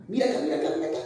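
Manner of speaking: high, teasing lure with a laugh; voicing the serpent. Preacher as the viper luring the eye, teasing. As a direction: dangle it in front of them.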